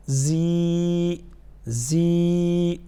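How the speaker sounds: long زی with a hard buzzing z. Shown as a demonstration. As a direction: press firmly on each زی and hold it. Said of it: "زا کو سخت پڑھیں گے". (سخت اور لمبی زی)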